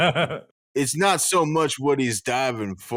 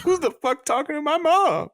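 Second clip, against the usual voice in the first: mock outraged yell. Sudden high mock outburst.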